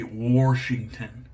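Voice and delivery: Washington with an added R, "Warshington"; mock mispronunciation. Shaver mimicking the weirdos, R added in Washington. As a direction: put a drawn-out R into the first syllable of Washington. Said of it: "like the weirdos say".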